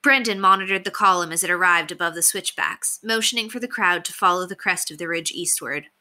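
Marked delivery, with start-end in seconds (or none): none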